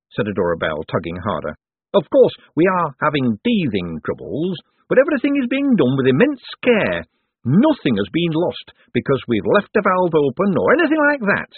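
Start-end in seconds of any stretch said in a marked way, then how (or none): 1.9-11.5 high, defensive boffin voice; over-explain anxiously, pitch climbing; nervous inventor insisting all is well; awkward eager economist. Acted.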